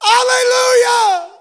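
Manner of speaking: high, loud hallelujah cry; ringing hallelujah shout. Acted. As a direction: throw the hallelujah up to heaven. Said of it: "And again they said".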